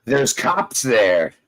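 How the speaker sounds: drawn-out mocking quote; slow mock quote. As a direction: drag each word out, mocking him. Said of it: "he got an alert".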